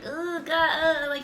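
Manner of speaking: high, awkward groan of discomfort; nervous squirm. Retold or imitated. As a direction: squirm out a high, flustered reaction. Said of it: "I just get so nervous that I'm like".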